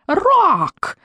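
high-pitched screeched cry; witch's screech. A narrator's reading. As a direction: screech like claws scraped across glass. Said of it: "взвизгнула ведьма, словно полоснула когтями по стеклу".

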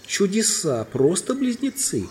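high, drawn-out amazement; exclaiming. A narrator's reading. Queen marvels at the twin boys, exclaiming. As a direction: marvel at it, pitch way up. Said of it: "вскричала королева".

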